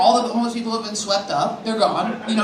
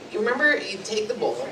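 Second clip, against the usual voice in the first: ordinary delivery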